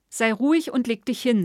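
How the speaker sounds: loud, firm command; firm and energetic. A narrator's reading. Wife ordering her drunk husband to bed: firm command. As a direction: put your foot down with him. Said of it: "mit energischer Stimme".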